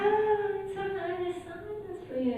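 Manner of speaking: sing-song sarcastic gladness, slow and high; mock glad. Actor at convention signing a hated show, mock sing-song glee. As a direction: fake delight through the teeth. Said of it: "I'm like".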